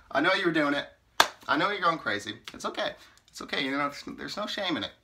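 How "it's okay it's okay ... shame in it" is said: usual speaking voice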